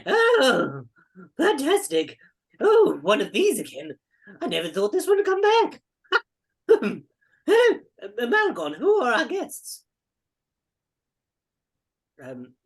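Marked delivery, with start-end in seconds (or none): 0.1-9.8 put-on high, loud old record keeper, delighted by visitors; excitable little old man. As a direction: exclaim in wonder as an eccentric old fellow. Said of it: "a hunched over little fellow".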